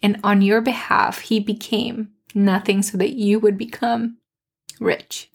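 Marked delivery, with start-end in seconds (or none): none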